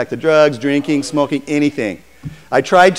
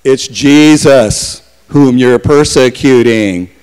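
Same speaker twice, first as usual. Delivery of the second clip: slow, louder, solemn voice of Jesus; 3 semitones lower, 8 dB louder, much slower than usual. Acted. Jesus answering blinded Saul, slow and solemn. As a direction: let each word fall slowly and heavily. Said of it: "he got the word back".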